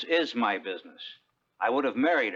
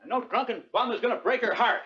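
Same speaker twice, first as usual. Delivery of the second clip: high, forceful scolding; angry; 13 semitones higher than usual. Acted. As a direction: lay down the law angrily. Angry friend confronts drunk Solomon.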